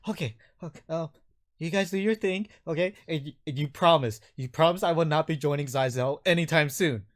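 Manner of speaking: high, anxious, eager voice; nervous captive. Acted. Captive seeking a promise from his captors, high and anxious. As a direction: agree nervously and beg for a promise. Game master plays captive man.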